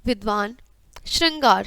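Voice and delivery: ordinary talk, nothing marked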